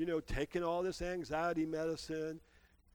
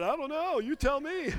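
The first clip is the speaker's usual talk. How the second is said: laughing retort; 10 semitones higher, 8 dB louder than usual. Retold or imitated. Cheeky reply to instructor.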